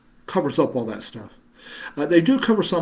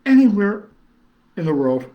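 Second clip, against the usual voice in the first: emphatic anywhere; 6 semitones higher, 6 dB louder than usual. Real, not acted. Enthusing about couch travel.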